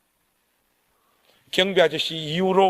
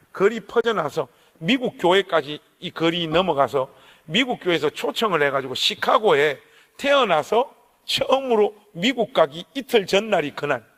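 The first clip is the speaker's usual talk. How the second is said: ordinary delivery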